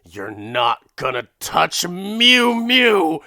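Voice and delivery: mock outraged yell; defiant cry. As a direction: shout it as she runs for the cat.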